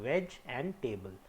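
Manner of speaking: veg and table, said slowly as two chunks; split in two parts. Shown as a demonstration. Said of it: "मैं इसको दो part में बोल रहा हूँ".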